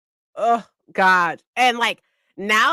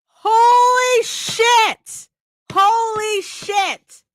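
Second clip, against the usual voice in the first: drawn-out high stunned exclaim, much louder; stunned holy shit; 5 dB louder, much slower than usual. Retold or imitated. Hearing the girl explain her OCD, drawn-out high stunned cry. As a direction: stretch it out, amazed and loud. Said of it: "And I'm like".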